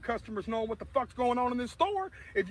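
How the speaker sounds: re-yell the rant at the manager; retold shouting. Singer re-enacting his rant at the store manager, loud and fast. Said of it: "I just screamed at everybody in the store".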